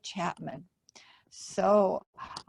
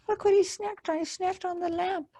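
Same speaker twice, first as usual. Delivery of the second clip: high fast astonished voice; shocked gasp; 8 semitones higher, much faster than usual. Real, not acted. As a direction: gasp and point out the surprise. Reader shocked at picture.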